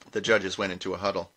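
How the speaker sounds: usual speaking voice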